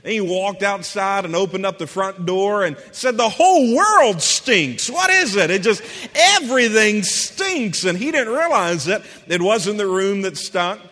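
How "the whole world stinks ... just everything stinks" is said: loud, high fed-up complaint; exasperated outburst. Retold or imitated. Roommate at the front door, loudly exasperated at everything. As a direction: throw up your hands at the world.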